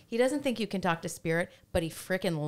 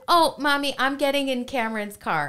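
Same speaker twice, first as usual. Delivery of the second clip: little daughter; 7 semitones higher, 7 dB louder than usual. Retold or imitated. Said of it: "She goes".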